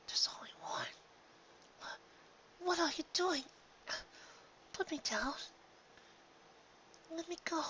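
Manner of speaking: soft high whisper, barely conscious; faint whispered pleading. Acted. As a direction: plead weakly, drifting in and out. Half-conscious, being dragged.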